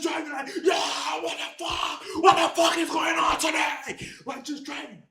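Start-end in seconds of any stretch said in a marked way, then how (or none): 0.6-4.1 strained, drawn-out imitated yelling; panicked retold shouting; mock scream. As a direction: act out screaming in a runaway car without full volume. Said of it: "I was just screaming like".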